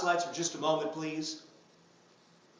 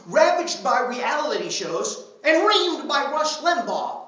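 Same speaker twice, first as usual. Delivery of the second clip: mock outrage; 9 semitones higher, 8 dB louder, slower than usual. Building mock-outraged oration.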